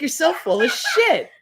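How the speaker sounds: laughing at grandma's soda lie. Real, not acted. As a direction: crack up while scolding.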